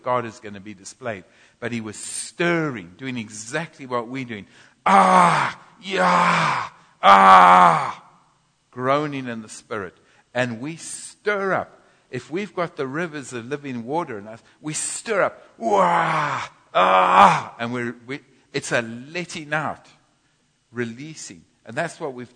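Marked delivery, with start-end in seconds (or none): 4.8-8.1 loud drawn-out groaning shouts, much higher; loud spirit groan. Shown as a demonstration. Preacher demonstrates stirring up to the church, loud groaning shouts. 15.6-17.5 loud groaning cries, much higher; loud release groan. Shown as a demonstration. Preacher shows how we stir up, loud groaning release.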